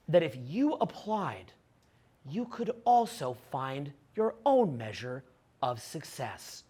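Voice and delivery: low, slow, measured lecturing voice; mocking a famous investor's calm lecture, low and slow; Ray Dalio impression. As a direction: drop low and slow, a grave self-assured sage. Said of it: "if you listen to Dalio".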